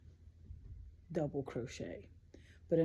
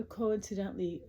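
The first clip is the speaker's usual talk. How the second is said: ordinary talk, nothing marked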